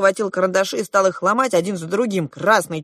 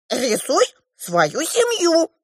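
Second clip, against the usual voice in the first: high, slow dwarf order; demanding dwarf; 12 semitones higher, much slower than usual. A narrator's reading. The dwarf orders the girl to draw, demanding. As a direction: demand it high, dragging out each word. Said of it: "потребовал карлик".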